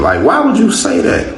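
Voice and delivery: a mother stunned by her son's rudeness; mock shocked squeal, pitched high.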